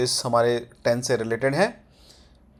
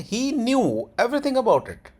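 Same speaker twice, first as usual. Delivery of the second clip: slow, pitched-up model sentence, KNEW drawn out high; sentence tone demo; 9 semitones higher, much slower than usual. Shown as a demonstration. Teacher modelling an English sentence's tone, slow demo. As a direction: stretch "knew" high, then fall on "about it". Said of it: "tone भी almost यही रहती है".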